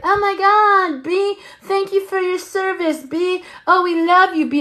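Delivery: high mock adoration; gushing relatives. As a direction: coo over him like adoring aunties. Family gushing over Marine.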